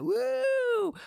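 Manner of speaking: high, loud "woo" whoop; crowd cheer imitation. Storyteller imitating everybody as the show starts, cheering. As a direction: whoop like an excited audience.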